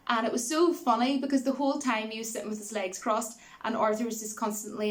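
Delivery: ordinary delivery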